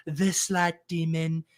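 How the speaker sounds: a slow, lightly put-on politician accent; Trump accent. Listing draft picks, slipping into a slowed, light Trump-style accent. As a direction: slip briefly into a mild presidential-style accent, slowed down. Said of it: "Don't know why I did that in a Trump accent".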